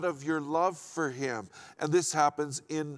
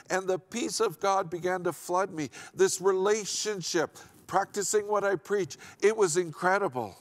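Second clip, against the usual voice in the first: raised, driving pulpit voice; preaching; 5 semitones higher, 4 dB louder than usual. Acted. Pastor urging the congregation to hear, full preaching voice.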